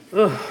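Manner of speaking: short grunt of disgust; a quick, revolted ugh. Acted. Preacher recoiling at lions eating raw meat, disgusted ugh.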